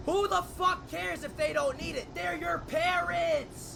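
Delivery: loud, slow outraged yell; angry shout. Real, not acted. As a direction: yell it in disbelief. Outraged at streamer not repaying parents.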